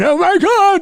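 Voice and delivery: high, loud panicked cry; alarmed. Retold or imitated. Loomis realizing Myers is back, high loud alarm. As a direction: cry it out as it dawns on him. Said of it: "he begins to think".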